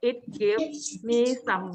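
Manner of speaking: slow, drawn-out reading; slow riddle clue; slow teacher clue. Shown as a demonstration. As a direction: read it word by word for the class. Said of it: "ประโยคสุดท้ายที่บอกว่า".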